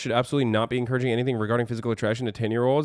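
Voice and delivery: very fast mimicking read-back; rapid-fire recap. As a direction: rattle through the quote. Rattles back the clip's words.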